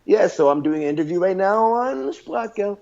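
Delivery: English on a high Portuguese melody; exaggerated, high-pitched Portuguese English; Portuguese-accented English. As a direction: mimic Portuguese intonation in English, climbing high. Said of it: "then it translates to their English".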